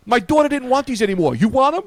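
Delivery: high, loud imitation of neighbor; hammed-up excited giving; eager neighbor offer.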